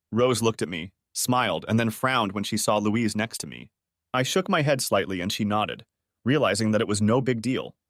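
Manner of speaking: ordinary delivery, nothing marked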